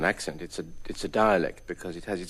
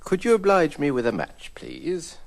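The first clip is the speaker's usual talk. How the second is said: posh request; 9 semitones higher than usual. Acted. Posh phrasebook line.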